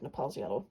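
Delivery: "Nepal's" said nuh-PALZ, stress on PALZ; own pronunciation. Shown as a demonstration. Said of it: "I've always just said".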